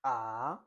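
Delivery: Mandarin second tone on AH, sliding upward. Shown as a demonstration. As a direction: let AH climb like a question. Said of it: "The second one is".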